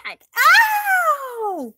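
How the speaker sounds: sharp shouted ow of pain. Acted. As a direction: yelp as if slapped on the head. Slapped on the head.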